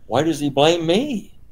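rising protest against being blamed; protesting objector. Acted. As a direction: voice a puzzled objection, pitch climbing. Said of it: "question that ... should arise in your mind".

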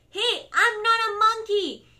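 loud, high, slow protest; mock outrage. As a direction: object hotly in play. Reader protests the book's words.

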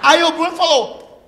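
ordinary talk, nothing marked

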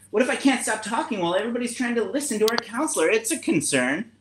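a parrot fretting it will talk too much; chatty parrot voice. Acted. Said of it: "trying to go a little bit higher or lower depending on each animal".

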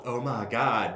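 normal talking voice

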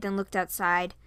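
ordinary delivery

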